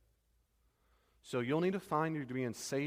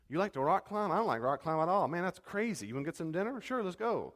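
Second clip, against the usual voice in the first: bright, higher, eager welcoming friend; friendly enthusiasm; 6 semitones higher, 3 dB louder than usual. Pretend. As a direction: play the accepting friend, upbeat. Acted welcoming friend dialogue.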